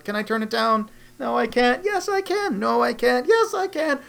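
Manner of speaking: high, squeaky back-and-forth waffling; dithering voice. Retold or imitated. Mocking a man torn over a prostitute in a squeaky voice. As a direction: swing between yes and no in a silly high voice.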